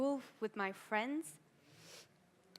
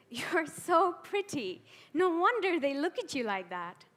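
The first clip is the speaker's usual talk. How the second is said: higher, louder voice of another person; acted outside voice, pitched up; quoted onlooker; 7 semitones higher, 6 dB louder than usual. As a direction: throw the remark at her, raised and pointed. Quotes what others say to her.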